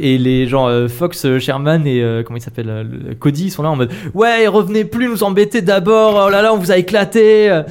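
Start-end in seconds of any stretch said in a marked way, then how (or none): 4.1-7.6 gloating taunt. Acted. Party members taunting beaten foes.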